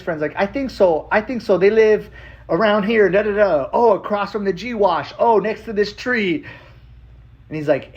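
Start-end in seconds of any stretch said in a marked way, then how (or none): 0.4-6.4 high imitation of giving local directions; imitated friend recalling places aloud; rez-style explaining. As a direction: pitch it up, piecing landmarks together. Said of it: "everyone has this way of explaining connections".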